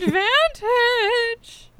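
very slow, high, stretched out; high drawn-out call. Real, not acted. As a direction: drag the word out high. Echoing advantage drawn out.

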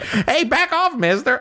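very high mock protest; skinny little hobo. Hobo facing Arthur's gun, high protest. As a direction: warn him off in a high put-on voice. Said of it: "like, a skinny little hobo".

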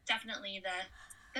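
ordinary talk

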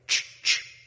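whispered racking sound; shotgun pump click. Retold or imitated. Preacher imitating the gun racking in the dark. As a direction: imitate a gun being cocked. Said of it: "I knew what that sound was".

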